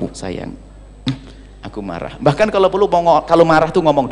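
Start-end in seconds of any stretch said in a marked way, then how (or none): 0.0-2.2 soft, gentle "I'm angry"; tender mock anger. Husband sweetly telling his wife he is angry, soft and tender. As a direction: coo the anger like an endearment.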